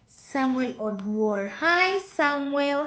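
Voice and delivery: ordinary delivery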